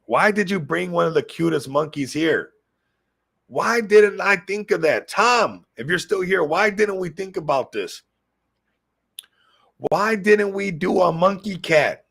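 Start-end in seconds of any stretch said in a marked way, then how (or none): 3.5-12.0 excited. Real, not acted. Excited about a clever coin idea.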